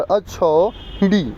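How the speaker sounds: अछौहिड़ी said very slowly, syllable by syllable; careful pronunciation. Shown as a demonstration. Teacher telling students to listen, slowly spelling out अछौहिड़ी. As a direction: stretch out अछौहिड़ी so every sound is clear. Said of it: "सुनना".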